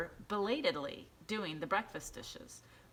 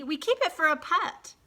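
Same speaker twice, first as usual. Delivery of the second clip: put-on high, louder child's voice; bright kid explaining her pet; little girl voice; 12 semitones higher, 10 dB louder than usual. A narrator's reading. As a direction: chirp it like a proud little girl.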